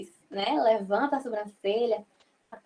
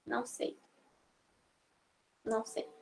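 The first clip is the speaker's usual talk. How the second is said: whispering não sei; whispered no; 2 semitones lower, 12 dB quieter than usual. Shown as a demonstration. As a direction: mouth it softly, almost no voice. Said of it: "aí você". (sussurrando não sei)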